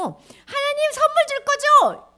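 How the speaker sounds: very high, loud, slow begging; eager asking. Acted. People asking God for gifts, eager. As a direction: ask with bright hope.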